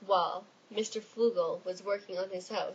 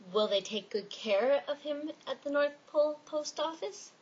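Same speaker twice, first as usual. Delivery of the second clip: worried question; 6 semitones higher than usual. Acted. Fogle asks, suddenly worried.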